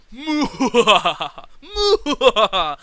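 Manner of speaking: slow, loud, hammed-up evil villain laugh; Doctor Evil laugh. Heels laughing too long, a loud slow hammed-up villain laugh. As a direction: drag out a mock evil laugh. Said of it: "you know that Doctor Evil".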